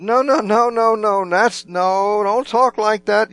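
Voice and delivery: high, loud, insistent mock protest; protesting exclamation. Peter objects to Jesus predicting his death, exclaiming in protest. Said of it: "his exclamation".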